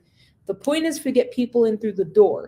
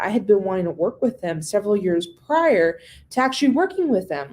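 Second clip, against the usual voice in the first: ordinary talk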